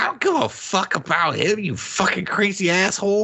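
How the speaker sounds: high-pitched hammed-up angry retort; mock outrage. Retelling the woman's refusal to help Pearl, mock outrage. Acted angry reply in film.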